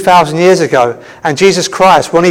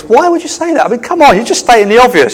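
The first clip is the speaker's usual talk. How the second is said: high-pitched, exasperated protest; mock incredulity; 7 semitones higher than usual. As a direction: push the pitch right up in playful disbelief. Acts own incredulous reaction to God.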